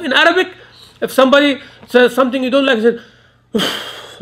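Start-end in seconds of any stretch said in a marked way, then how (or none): 3.5-4.2 breathy whispered huff of annoyance; annoyed uff. Shown as a demonstration. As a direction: let out an exasperated puff of air. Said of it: "like this".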